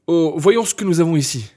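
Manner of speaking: usual speaking voice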